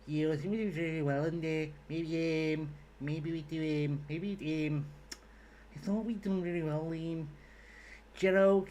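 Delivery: hesitant Scouse pundit, um-ing and maybe-ing; Jamie Carragher impression. Mocking Jamie Carragher's post-match interview in Scouse accent. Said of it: "my Scouse accent did start as a Jamie Carragher impression".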